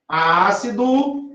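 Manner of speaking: drawn out with a rising tune; Ácido drawn slowly, rising at the end; slow demo. Shown as a demonstration. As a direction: stretch it out as a cue for the class. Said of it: "Muito bem".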